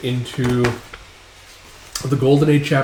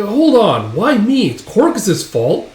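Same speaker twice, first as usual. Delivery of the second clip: high, loud objection; exasperated acted whine of protest; indignant protest; 9 semitones higher, 4 dB louder, slower than usual. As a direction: complain about being picked, voice raised. Casca protests an order.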